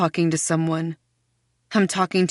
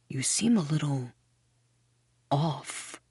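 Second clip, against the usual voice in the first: quiet; 3 semitones lower, 7 dB quieter than usual. A narrator's reading. Goblin whispering concern.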